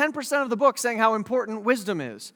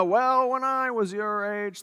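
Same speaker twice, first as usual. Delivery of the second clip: put-on slow, drawn-out old-timer voice; mock old-man reminiscing; rambling old man; slower than usual. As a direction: drag it out like grandpa starting a story. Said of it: "ramblings of an old man".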